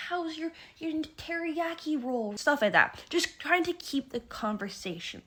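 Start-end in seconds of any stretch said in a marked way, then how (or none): 0.1-2.4 polite small talk. Retold or imitated. Mock polite small talk question.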